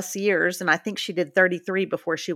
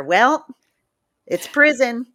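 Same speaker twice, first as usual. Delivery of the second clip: loud, raised-pitch mock retort; mocking dismissiveness; 3 semitones higher, 7 dB louder than usual. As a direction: throw it out loudly like a scornful critic. Mocking people who dismiss prison complaints.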